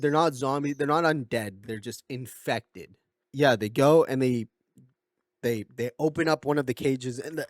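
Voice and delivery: ordinary delivery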